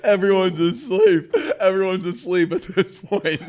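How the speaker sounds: loud, high, helpless laughter through words; laughing hard. Real, not acted. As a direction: barely get it out through real laughter. Cracking up while telling story.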